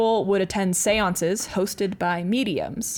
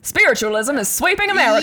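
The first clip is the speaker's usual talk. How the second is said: put-on glamorous old film star transatlantic accent; Mid-Atlantic accent, grand and clipped vowels; 6 semitones higher, 5 dB louder than usual. Shown as a demonstration. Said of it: "Can you do that in a transatlantic accent?".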